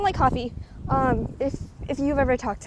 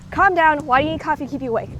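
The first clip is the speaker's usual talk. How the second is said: loud, fast 'calm down' to coffee addicts; exasperated retort; 6 dB louder than usual. Retold or imitated. To coffee-craving people at exam time, a loud, fast exasperated retort. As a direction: push back hard and quick, mock impatient. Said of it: "And I'm just like".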